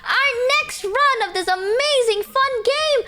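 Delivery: Ollie impression. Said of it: "this is what she does".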